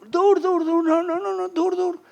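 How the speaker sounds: put-on very high, urgent kid's cry; child shouting stop. Boy on a bike yelling at riders to stop. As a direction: squeal it like a boy chasing you.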